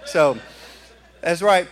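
usual speaking voice

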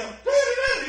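put-on very high, excited child voices; kids calling. Children running to their father, high excited calling. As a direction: squeal like happy little kids. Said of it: "And they go".

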